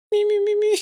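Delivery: high cracked singer's warm-up; croaky vocal warm-up. Retold or imitated. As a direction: test a broken voice. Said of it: "I woke up, I said".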